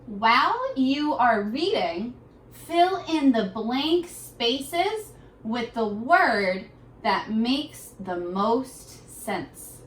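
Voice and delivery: very slow, clear reading pace; slow read-aloud. Shown as a demonstration. Teacher reading instructions slowly for children to follow. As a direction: read it slowly so kids can follow along. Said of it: "Read along as I read it to you".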